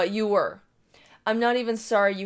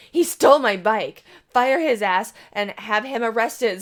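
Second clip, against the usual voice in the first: louder, slower indignant accusation; outraged demand; 6 dB louder than usual. Retold or imitated. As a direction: insist like she's been robbed.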